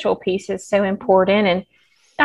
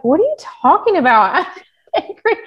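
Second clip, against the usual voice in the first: protest, then crack up laughing; mock indignant protest; 9 semitones higher than usual. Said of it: "And I was like".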